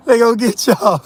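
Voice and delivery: words breaking into a cackle; laughing. Real, not acted. Teasing listeners about getting caught, laughing. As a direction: say it through real laughter. Laughing at his imagined interview.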